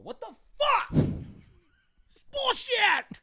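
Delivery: high, slow, whiny outraged passenger; exaggerated high-pitched complainer impression. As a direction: mimic an entitled traveler griping about the delay.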